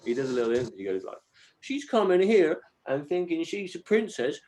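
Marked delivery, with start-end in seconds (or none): 1.6-4.4 put-on much higher, indignant child voice; eloquent five-year-old. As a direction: imitate a little boy making a grand complaint. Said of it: "he talks so eloquently for, like, a five-year-old".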